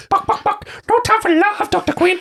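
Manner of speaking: high clucking bird voice; bird companion. A narrator's reading. Narrator voicing the bird companion, high clucking voice. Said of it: "says Nykron".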